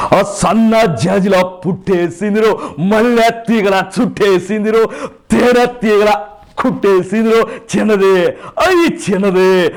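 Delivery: loud, high, drawn-out hero delivery; stretched, punchy star impression; Chiranjeevi. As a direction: mimic a film star booming out song lyrics, then ease down. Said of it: "first Chiranjeevi గారితో start చేస్తాను".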